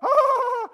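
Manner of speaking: high mock wail; hammed-up gasp of awe; awestruck cry. Rider hearing how strong the Lord is, high awestruck cry. Rider overwhelmed by Jesus' strength.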